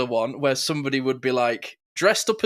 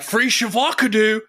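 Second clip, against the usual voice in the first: high put-on meme voice; imitated viral-video lady; 8 semitones higher, 4 dB louder than usual. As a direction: belt out the lady's mock misreading. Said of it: "I'm like".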